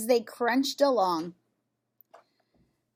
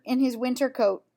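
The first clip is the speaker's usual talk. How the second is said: plain reading voice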